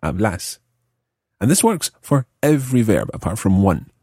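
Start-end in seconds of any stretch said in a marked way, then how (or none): 0.0-0.6 hablas said as ab-LÁS; stressed second syllable. Shown as a demonstration. Teacher repeating the example, hablas stressed on second syllable. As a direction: put the weight on the end of "hablas". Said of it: "with a stressed second syllable".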